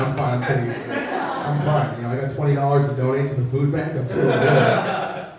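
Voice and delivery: usual speaking voice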